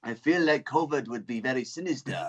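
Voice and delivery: covid as a sinister French villain; sinister French accent. Acted. Comic improvising covid's accent for his podcast host, sinister French. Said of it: "Why is he French?".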